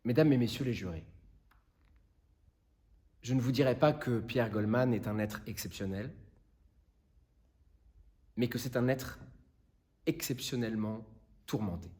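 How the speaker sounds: low, hushed plea sinking towards a near whisper; grave closing words with long pauses, fading away; solemn appeal. Acted. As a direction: plead from the heart, softly and gravely. Lawyer's solemn final appeal to jury.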